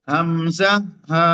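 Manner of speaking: হামজা হা from the very start of the throat; Arabic hamza and ha from the throat; Arabic throat letters. Shown as a demonstration. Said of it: "কণ্ঠের একবারে শুরু থেকে".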